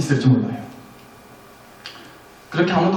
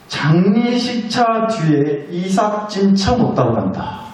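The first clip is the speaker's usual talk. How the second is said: slow proverb; much slower than usual. Shown as a demonstration. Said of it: "무슨 말인지 아시겠어요?".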